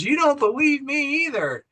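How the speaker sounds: high-pitched mock dismay; retold protest. Woman accusing Carl of doubting her, mock dismayed.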